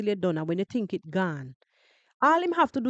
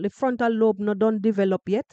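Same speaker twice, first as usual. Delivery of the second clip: normal talking voice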